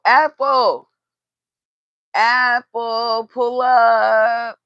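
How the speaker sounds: loud, high call for someone. Real, not acted. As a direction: yell her name to come over. Calling out for her sister.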